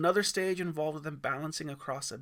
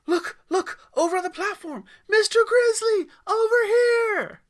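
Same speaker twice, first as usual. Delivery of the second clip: excited shout; 14 dB louder than usual. Acted. Boy shouting at the fire to Mister Grizzly, excited. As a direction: yell across the camp, thrilled. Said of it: "Aiden gasped and interrupted".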